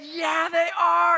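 high, loud, drawn-out affirmation; retold exultant shout. Pastor confirms the shoes are his, retold exultant shout. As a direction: shout it back slowly, re-enacting the joy.

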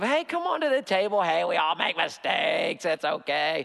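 put-on chummy voice excusing everything; mock condoning. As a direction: wave it all away like a pushover. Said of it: "there's not this condoning of".

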